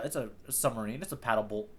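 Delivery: ordinary delivery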